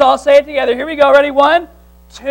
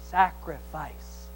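usual speaking voice

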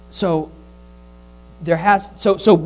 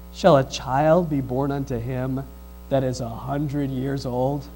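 little inner voice; 7 semitones lower, 6 dB quieter, slower than usual. Retold or imitated. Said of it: "you hear the little voice come up".

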